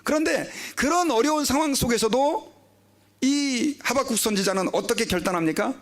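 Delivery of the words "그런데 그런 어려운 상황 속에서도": ordinary talk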